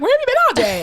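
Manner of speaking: high, sharp mock mom question; hammed-up mother asking where you were; mom's demand. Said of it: "my mom was like".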